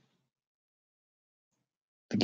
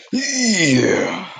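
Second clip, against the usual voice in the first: high-pitched attacking yell; battle cry; 11 semitones higher, 4 dB louder than usual. Pretend. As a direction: let out a high charging cry. Battle cry in game fight.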